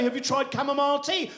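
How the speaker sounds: high, laughing adviser voice; mock adviser. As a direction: offer the tip in a high, amused voice. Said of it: "I was gonna say".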